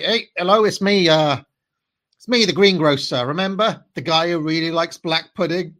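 hammed-up Cockney shopkeeper greeting; Cockney impression. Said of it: "most stereotypical Cockney conversations".

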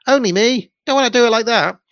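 high, loud whiny know-it-all; Only Me impression. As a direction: butt in with a shrill high whine. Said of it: "the Harry Enfield character, Only Me".

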